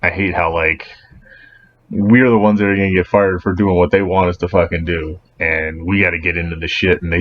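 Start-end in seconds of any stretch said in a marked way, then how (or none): none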